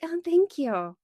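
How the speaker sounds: high-pitched mock-polite thank you; insincere thanks. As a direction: imitate a thanks you don't really mean. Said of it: "they didn't mean it".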